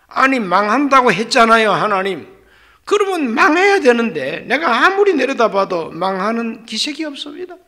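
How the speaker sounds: loud, high protest to God; angry complaint. Retold or imitated. Prophet Jonah complaining angrily to God about Nineveh. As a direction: Jonah fuming that the city stands. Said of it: "막 성을 냈어요".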